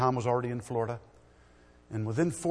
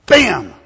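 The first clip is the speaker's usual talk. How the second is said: crash noise; 5 semitones higher, 16 dB louder than usual. Retold or imitated. Car crash in story.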